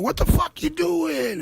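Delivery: a hint of a startled mother, pitched high; mother scolding. Mother catching her three-year-old, lightly voiced high scold. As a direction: toss it up high like a shocked mom. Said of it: "you know, saying".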